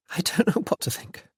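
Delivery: a soft, unsteady whisper of doubt; trembling voice. A narrator's reading. Anne unsure about Nancy, voice trembling softly. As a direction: let the words tremble, barely voiced. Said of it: "there was a tremble in her voice".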